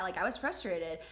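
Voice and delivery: usual speaking voice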